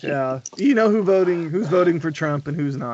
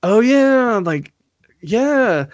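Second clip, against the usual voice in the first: higher-pitched drawn-out acted "oh, yeah"; acted reaction; 7 semitones higher, much slower than usual. Host recalling the feel with The Cure, acted reaction. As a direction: act out an easy "oh yeah", pitched up. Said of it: "it was more of like".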